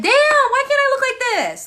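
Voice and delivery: mock envious squeal. Imagining scrolling past the client's photo, high envious squeal. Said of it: "I'd be like".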